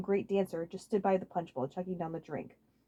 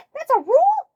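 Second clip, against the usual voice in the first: very high, loud shocked squeal; shocked disbelief; 13 dB louder than usual. Acted. Waluigi shocked by Toadsworth's rule, very high squeal. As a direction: gasp it in disbelief. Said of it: "mouth dropped open in shock".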